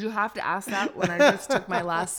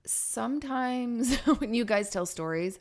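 ordinary delivery

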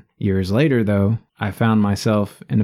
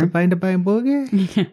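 squeaky put-on voice; 10 semitones higher than usual. Teasing mishearing.

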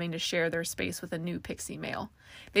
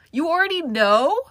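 high, loud shriek of disbelief; 9 semitones higher, 12 dB louder than usual. A narrator's reading. Younger sister learning of Carmel's baby plans, shrieking. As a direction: shriek the question at your sister.

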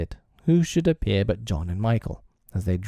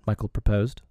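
usual speaking voice

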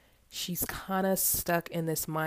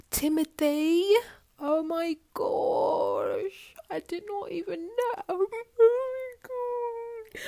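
much higher, tearful and overwhelmed, slow; crying in shock; 5 dB louder, slower than usual. Acted. Sheila seeing Timothy at her workplace, crying much higher. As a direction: sob out his name in disbelief. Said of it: "Sheila starts crying".

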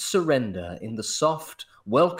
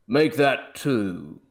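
scoffing; slower than usual. Acted. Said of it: "scoffed M".

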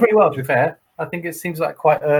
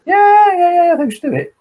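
much higher, louder, gushing yes; eager agreement; 7 dB louder than usual. Retold or imitated. Wife instantly agrees to the castle trip, squealing yes. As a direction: leap at the idea.

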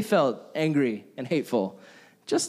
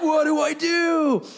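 helpless wail; 12 semitones higher, 3 dB louder, slower than usual. Retold or imitated. Helpless after calls fail.

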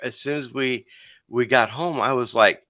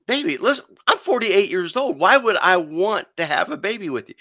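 much higher, laughing off the idea; incredulous dismissal; 7 semitones higher than usual. Retold or imitated. Husband telling his wife he is too old for a baby, incredulous. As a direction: brush it off, pitch up in disbelief.

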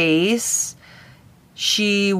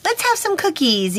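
much higher doting granny voice; sweet lovey-dovey grandma; 10 semitones higher than usual. Retold or imitated. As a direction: coax a grandchild in for a hug and cookies. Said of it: "had the energy of".

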